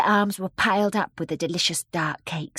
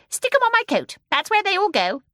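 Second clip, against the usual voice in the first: high, loud, bossy little woman; brisk old shopkeeper; 14 semitones higher, 7 dB louder than usual. Acted. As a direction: order them in a high, eager voice. Old shopkeeper gives brisk order.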